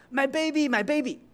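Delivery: high, frantic cry of a father; panicked father calling from upstream, retold; retold shout. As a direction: call out for a child in the water. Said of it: "一个爸爸在那喊".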